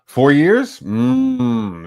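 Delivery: high surprised question, then high hum; mock impressed. Judge asking a would-be king how late, mock impressed. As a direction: pretend to be impressed by the answer.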